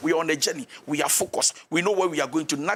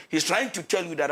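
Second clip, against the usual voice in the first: usual speaking voice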